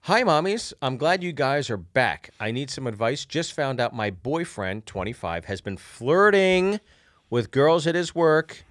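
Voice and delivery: lively letter read; reading a letter aloud. Acted. Reads listener letter aloud.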